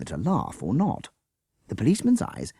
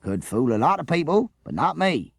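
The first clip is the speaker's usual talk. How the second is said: lightly voiced policeman, raised and pleased with himself; proud policeman; 10 semitones higher, 4 dB louder than usual. A narrator's reading. Policeman to the teachers, a proud gloat. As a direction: crow it a little, proud of his cleverness. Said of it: "he finished proudly".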